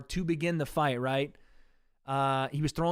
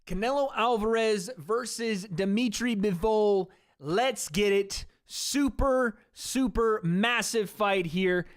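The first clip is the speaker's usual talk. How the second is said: slow, high-pitched hype intro; fight announcer; 5 semitones higher than usual. Pretend. Streamer introducing Canelo versus Bivol, announcer voice. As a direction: build up the big fight, drawn out.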